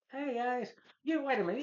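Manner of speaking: high-pitched mock surprise; surprised Peter. Peter realizing the robbers are fakes, high and surprised. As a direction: squeak it out, catching on. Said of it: "He's like".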